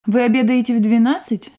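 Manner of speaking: ordinary talk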